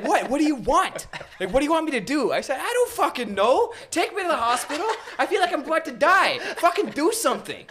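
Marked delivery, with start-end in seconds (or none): 0.0-2.3 high, loud acted exasperation; irritated yell. Uncle back at washroom door, imitated irritated yell. 2.6-7.7 high, loud acted exasperation; desperate yelling. Patient begging uncle for hospital, acted desperate shouting.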